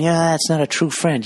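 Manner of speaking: high, put-on Jersey guy agreeing; mimicking the housemates, high and smug; housemates' impression. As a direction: pitch it up and nod along smugly. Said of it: "sitting there going".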